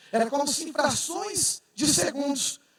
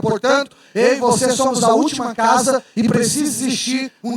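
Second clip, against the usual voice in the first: loud, building sermon climax, calling out to the church; preaching at full force; 7 dB louder than usual. Acted. Pastor rousing his church to say hallelujah, full preaching voice. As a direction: rouse the congregation, louder and louder.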